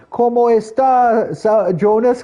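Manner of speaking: high, loud, drawn-out tourist hello; goofy mock Spanish greeting. Gringo greeting his Brazilian friend in bad Spanish, high and loud. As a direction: ham up a clueless gringo greeting.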